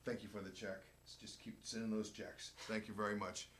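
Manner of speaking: quiet sly aside. Retold or imitated. Player bowing to his manager, softly joking under his breath. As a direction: mumble it politely while bowing, tongue in cheek. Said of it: "here's what I would say when I would bow".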